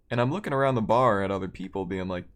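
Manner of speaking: usual speaking voice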